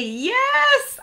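squealed high and loud yes; excited yes. Real, not acted. Host cheering a viewer's comment, excited yes. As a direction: burst out with delight.

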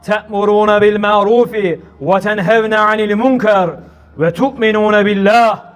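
slow, measured recitation of the verse; Quran recitation. Acted. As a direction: intone the Arabic verse slowly and solemnly. Quran verse recited at sermon start.

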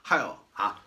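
ordinary delivery

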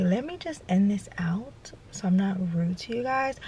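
soft unvoiced whisper. Real, not acted. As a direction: whisper it under your breath. Whispering to the camera.

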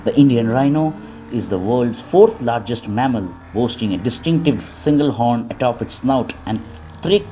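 calm, even wildlife narration; nature documentary. Acted.

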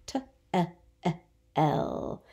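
T-E-E-L. with the vowel drawn out long; elongated vowel demo; stretched vowel. Shown as a demonstration. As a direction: stretch the E in Tell. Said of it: "How about bed".

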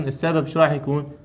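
ordinary delivery

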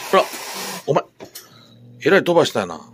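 loud high surprise at the pet's mess; surprised exclaim. Real, not acted. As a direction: react loudly to the mess.

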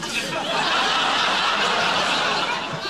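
a high airy burst of real laughter; high breathless laugh. Real, not acted. She cracks up telling the host about the knickers, high airy laugh. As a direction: laugh helplessly at the memory. Laughing at her own story.

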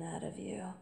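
trailing off into a whisper; soft sultry whisper. Acted. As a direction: drop the end to a breath.

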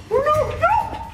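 high panicked squeak. Real, not acted. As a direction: shriek the no's in panic.

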